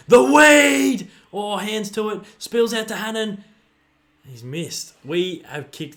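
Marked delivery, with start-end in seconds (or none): 0.1-3.4 high, loud outburst of joy; excited shout. Real, not acted. Fan watching the game live, shouting in excitement. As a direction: scream it as the play breaks open.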